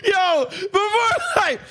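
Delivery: laughing shout. Real, not acted. Laughing, shouting about fog.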